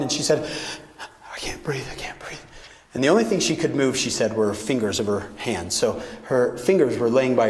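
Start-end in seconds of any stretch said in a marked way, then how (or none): 1.3-2.4 softer, trailing into a bare whisper; fading whisper. Retold or imitated. Speaker retells Meg on the ground, fading whisper. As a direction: let the voice give out to a whisper. Meg quoted lying injured.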